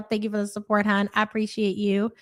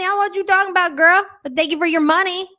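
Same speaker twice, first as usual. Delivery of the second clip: loud sassy retort; 6 semitones higher, 5 dB louder, much faster than usual. Real, not acted. Teasing reply to a viewer.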